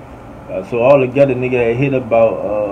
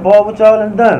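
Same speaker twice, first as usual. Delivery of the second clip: high-pitched astonished question; raised voice, mock alarm; imitated disbelief; 8 semitones higher, 4 dB louder than usual. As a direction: mimic a startled friend demanding answers. Friend reacting as they burst in.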